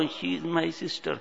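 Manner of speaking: cornered man mutters it under threat; meek forced admission. Acted. Made to say it by crew, meek and soft. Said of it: "کہو ورنہ ابھی phone کرتا ہوں".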